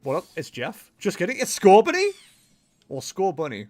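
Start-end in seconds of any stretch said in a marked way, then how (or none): 1.0-2.1 rising playful squeal; playful high. Acted. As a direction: toss it off high and bright. Playful mispronounced name.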